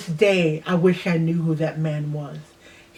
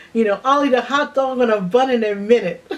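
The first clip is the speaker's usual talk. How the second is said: laughing; 5 semitones higher, 5 dB louder than usual. Real, not acted. Laughing about liking hot dogs now.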